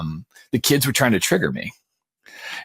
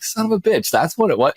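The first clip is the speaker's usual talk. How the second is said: mock dismay; 7 semitones higher than usual. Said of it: "And I'm like".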